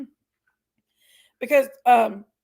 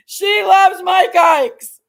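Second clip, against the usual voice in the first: higher, louder and slow; loud drawn-out; 7 semitones higher, 9 dB louder, much slower than usual. Real, not acted. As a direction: stretch it out playfully and loud. Teasing about dog and candy.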